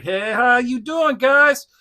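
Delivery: high, loud goofy greeting; silly Quick Kick voice. Retold or imitated. Imitating Quick Kick as a goofy, loud greeter. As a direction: hype it up like a silly cartoon hero. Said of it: "Quick Kick was more like".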